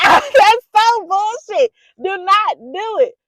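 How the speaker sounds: loud, high and slow, each word hammered; heated yelling. Real, not acted. Vlogger warning viewers never to go back, slow heated yell. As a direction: really lose your cool and shout it at them. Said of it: "Sorry, I got a little heated".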